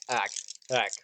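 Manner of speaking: half-shouted relay from a driver; mock screamed relay. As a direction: call it back to the crew, mock-screaming. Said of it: "or screams back".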